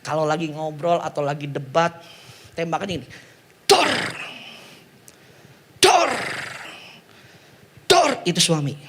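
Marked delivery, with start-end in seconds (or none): 3.6-8.2 gunshot sounds. Acted. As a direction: fire off bangs. Imitating pistol shots.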